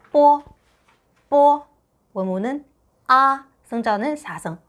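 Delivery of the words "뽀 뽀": 뽀 said loud and higher as the initial b; Mandarin b sound. Shown as a demonstration. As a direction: stress the 뽀 initial clearly. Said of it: "성모는 뭐였어요". (성모 뽀를 크고 또렷하게)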